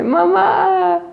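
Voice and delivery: high, loud call of a lost cub; loud rising call to mama; shouting for mom. A narrator's reading. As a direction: cry out for mother from under the snow. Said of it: "otra vez grita".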